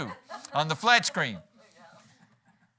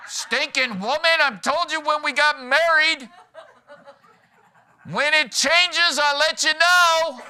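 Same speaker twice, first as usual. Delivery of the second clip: high, loud, slow hammed-up grumpy husband; gruff husband; 13 semitones higher, 11 dB louder, slower than usual. Shown as a demonstration. Preacher mocking a gruff husband to his wife, loud. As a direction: growl it out like a put-upon husband. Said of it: "said the same words, different way".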